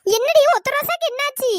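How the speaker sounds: high, loud, alarmed question; startled question. Acted. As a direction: react to sudden bad news. Startled by bad news of friend. (அதிர்ச்சியா கேக்குறது)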